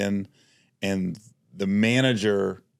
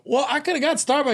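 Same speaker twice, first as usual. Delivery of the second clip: much higher, whiny exasperated protest; mock exasperated reply. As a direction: play up your own annoyance at the detour. Retold own exasperated reply.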